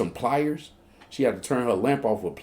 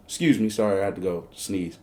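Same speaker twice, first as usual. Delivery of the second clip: normal talking voice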